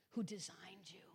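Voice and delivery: sudden hushed whisper on the last phrase; whispered aside to the students. Real, not acted. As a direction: drop to a breathy whisper for emphasis. Building list of God's roles.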